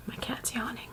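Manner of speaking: hushed whispered aside; whispering. Real, not acted. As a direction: whisper it so the cat isn't disturbed. Aside about cat during talk.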